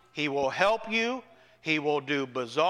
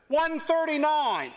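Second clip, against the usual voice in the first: calling out; 9 semitones higher, 3 dB louder than usual. Retold or imitated. Congregant calls out psalm.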